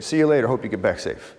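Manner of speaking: quick, breezy see you later; casual brush-off. Shown as a demonstration. Speaker contrasting a flat goodbye with a blessing, breezy and quick. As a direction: toss it off like a throwaway goodbye. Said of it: "The other is like".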